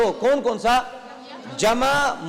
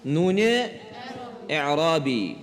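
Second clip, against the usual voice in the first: term split by a long pause; slow dictation; 5 semitones lower, 4 dB quieter, slower than usual. Shown as a demonstration. Teacher leads students through the term, slowly with a pause. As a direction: say نون, pause, then a lower, drawn-out اعرابی.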